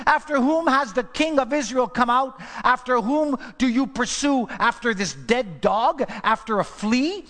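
loud, incredulous, belittling himself; voicing David. Preacher as David calling out to King Saul, loud pleading.

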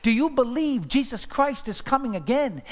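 normal talking voice

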